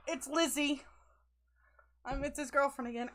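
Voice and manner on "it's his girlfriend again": normal talking voice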